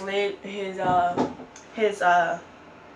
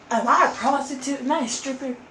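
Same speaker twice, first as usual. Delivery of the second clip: higher, pained rhetorical questions, real hurt; indignant outrage; 5 semitones higher, 3 dB louder than usual. Real, not acted. Still angry at the father, she asks higher and indignant. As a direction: fling the questions out in disbelief. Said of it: "that's what really pisses me off".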